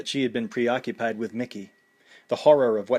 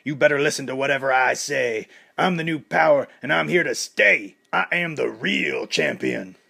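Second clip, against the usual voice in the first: loud arrogant boast, slowing at the end; swaggering shouted gloat; ranting; 3 semitones higher, 4 dB louder than usual. A narrator's reading. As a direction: brag to the booing crowd like the new champ. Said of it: "Clubber ranted".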